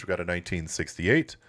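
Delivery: ordinary talk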